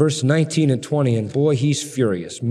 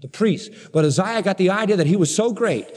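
raised, driving sermon voice; fiery preaching; 4 semitones higher than usual. Acted. Preacher stirring up his congregation about King Uzziah, fiery. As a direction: build the story of the king with mounting force.

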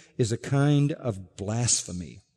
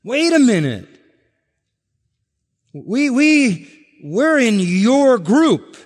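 higher, louder, slow protest; indignant protest; 8 semitones higher, 5 dB louder, slower than usual. Acted. The many at judgment protesting to the Lord, indignant and pleading. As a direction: plead as if wrongly shut out.

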